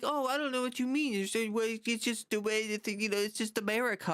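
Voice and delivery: high, airy mock-innocent dodge; mocking evasive politician. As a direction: play dumb like a cornered politician.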